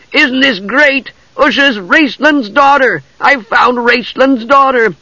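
very high, drawn-out kender glee; excited. A narrator's reading. Tass announcing he found Raistlin's daughter, high-pitched excitement. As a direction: crow it slowly in a high voice. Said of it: "hopping about in excitement".

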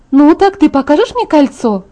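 high, lively demanding question; eager. Acted. Friend grabbing for the bride's ring, eager.

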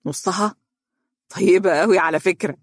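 lightly put-on disbelief, then teasing; feigned astonishment. A narrator's reading. Friend answering the mother's teasing, feigned astonishment. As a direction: echo it as a mock-shocked question. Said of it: "قال بدهشة مصطنعة".

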